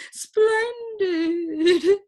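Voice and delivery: high, loud delighted gentleman; gleeful high Splendid; happy. A narrator's reading. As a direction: crow it out with glee. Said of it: "he said happily".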